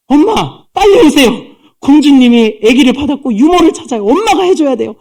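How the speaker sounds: put-on high, excited girl's plea; acted child bringing breathless news, high and insistent; urgent daughter. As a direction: call mother over urgently, pitched right up. Miriam urgently summons her mother.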